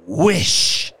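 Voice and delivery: high, loud one-word order; commanding cry. A narrator's reading. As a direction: order it forcefully and urgently. Wife commands frightened husband to wish.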